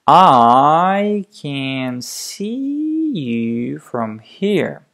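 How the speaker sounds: very slow, each syllable tapped apart, softening at the end; one syllable per finger. Shown as a demonstration. Stuttering coach demonstrating the hand technique, very slow. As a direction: lay each word down slowly with a finger. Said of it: "one finger, one syllable".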